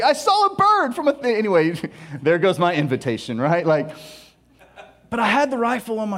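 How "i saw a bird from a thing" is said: flustered excuse. Retold or imitated. Defending shooting up the place on the dove hunt, flustered. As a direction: blurt it out defensively.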